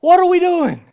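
the same believer pleads with God again, in a near shout. Retold or imitated. His frustrated cry to God.

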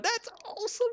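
way up high, thin and soft, mock-excited; high excited squeal. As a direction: squeak it out like a thrilled lady. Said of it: "I was just sitting there going".

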